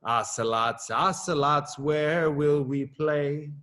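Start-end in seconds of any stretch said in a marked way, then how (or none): none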